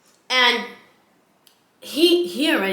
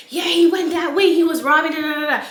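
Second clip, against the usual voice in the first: high, raised-voice mock report; excited witness; 5 semitones higher, 3 dB louder than usual. People telling cops about a robbery, high excited imitation. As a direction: rattle it off high like an agitated witness.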